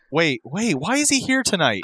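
high, put-on woman's voice; startled wife. Wife spotting her husband at her commentary job, mock startled. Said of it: "Can you imagine her, like".

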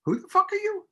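high-pitched acted challenge; mock movie tough guy. Guest acting a movie line in the cold open, mock tough guy.

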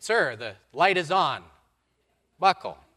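loud, high warning; firm order. Acted. Flight crew ordering the standing pastor to buckle up, loud. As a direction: snap out a command to sit.

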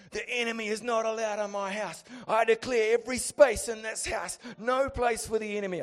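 high, loud preacher's bravado; preachy declaring. Acted. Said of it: "I started getting all preachy on it".

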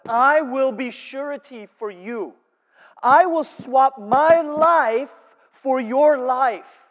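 loud, slow, high earnest pledge; solemn vow. Retold or imitated. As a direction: swear it slowly as if offering your life.

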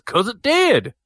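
high-pitched, emphatic mock retort. Commentator answering his partner's question, high mock retort. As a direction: lightly imitate a commentator's indignant comeback. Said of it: "And J R just says".

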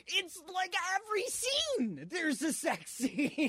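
high, strained exasperation, laughing at the end; exasperated protest. Retold or imitated. Complaining to friends about sex scenes, high exasperated protest. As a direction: squeal it out in disbelief. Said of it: "I'm like".

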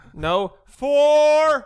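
high, loud warning shout; shout fore. Retold or imitated. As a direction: yell it across the course. Yelling a golf warning.

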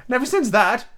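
high, mournful Hatter; hammed-up woe. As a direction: lament it in a high character voice. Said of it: "went on in a mournful tone".

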